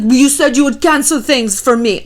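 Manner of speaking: put-on petulant, vague European accent; general European accent. Boyfriend mad about press duties, mock European accent. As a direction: whine it in a made-up European accent. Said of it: "I don't even know what this accent is, it's just, like, general Europe".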